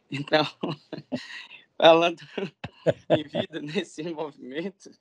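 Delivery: talking through real laughter; words broken up by laughs; laughing. Real, not acted. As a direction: try to resume while still laughing. Talking through laughter after joke.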